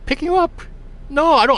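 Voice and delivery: high-pitched, disbelieving echo of the question; incredulous. Retold or imitated. Man mistaken for a ride driver, incredulous denial. Bewildered reply to mistaken passenger.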